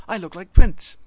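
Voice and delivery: high, loud, showy boast. A narrator's reading. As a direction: preen like a pop star. C sharp brags.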